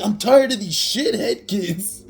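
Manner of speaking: high, loud, drawn-out mock rant; mock fed-up yell. Principal about to jump over the kids, mock fed-up yell. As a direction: holler it slowly like someone at the end of their rope.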